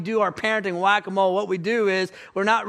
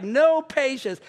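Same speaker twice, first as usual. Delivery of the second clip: imitating kids, higher pitch; kids' firm verdict; 5 semitones higher, 4 dB louder, slower than usual. Kids grading their dad, imitated as emphatic.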